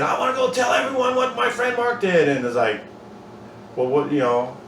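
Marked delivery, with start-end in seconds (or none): 0.0-2.3 high, eager voice of the rescued friend; excited rescued friend. Pretend. Friend he saved from a fire, bursting to tell everyone. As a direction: gush it like the grateful friend wanting to tell everyone.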